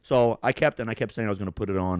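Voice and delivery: ordinary delivery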